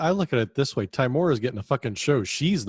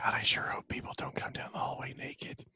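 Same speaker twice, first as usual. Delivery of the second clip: hushed whisper; 10 dB quieter than usual. Pretend. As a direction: keep it to a breathy whisper. Whispering banter.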